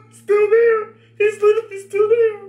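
high, loud laughing shriek; scream-laugh in disbelief; shrieking laughter. Real, not acted. Shocked at stranger on screen.

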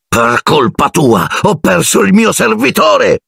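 slow, high-pitched outburst of rage; furious accusation. A narrator's reading. Malfoy blames Harry for losing his servant, furious. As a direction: rage at the culprit, drawing out each word.

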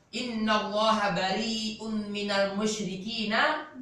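slow chanted recitation of the verse; Quranic recitation. Shown as a demonstration. Teacher reciting verse to class in slow chant. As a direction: recite the verse slowly, melodically.

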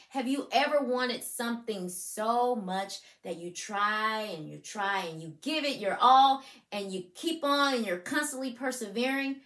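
usual speaking voice

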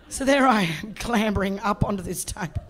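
ordinary delivery

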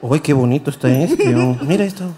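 ordinary delivery

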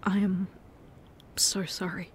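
ordinary talk, nothing marked

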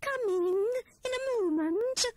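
very high, loud squeaky answer from upstairs; squeaky Squirrel. A narrator's reading.

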